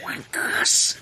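whispered "Wankers"; breathy mock insult under the breath; unvoiced whisper. Real, not acted.

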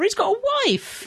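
phone voice. Retold or imitated. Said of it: "What was that you just did?".

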